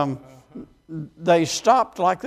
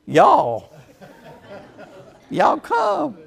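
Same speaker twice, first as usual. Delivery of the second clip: laughing mock North Carolina mountain drawl of y'all; North Carolina mountain accent; 6 semitones higher, much slower than usual. Preacher imitating his students in a North Carolina mountain accent, amused. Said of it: "she's got this North Carolina mountain accent".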